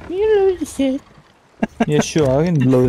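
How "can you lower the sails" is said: very high squeaky parroting of a friend; mocking mimicry. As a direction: throw it back in a tiny high voice. Mimics friend's request back.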